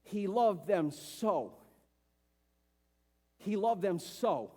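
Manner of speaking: very slow, weighty, stress on loved; slow emphasis. Shown as a demonstration. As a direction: let each word land for note-taking. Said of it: "write this down for point two".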